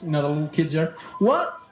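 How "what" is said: put-on excited kid. Kid in TV commercial.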